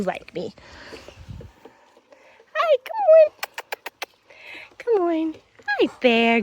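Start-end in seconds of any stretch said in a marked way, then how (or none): 2.5-6.4 high excited hello to a dog; excited dog greeting. Real, not acted. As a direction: greet the dog with a bright squeak.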